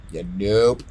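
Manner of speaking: normal talking voice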